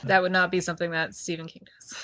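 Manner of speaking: unvoiced, conspiratorial whisper; whispered aside. Real, not acted. Podcast host whispers a jab about Stephen King, hushed. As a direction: whisper it like a secret dig. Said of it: "whispered aside about Stephen King".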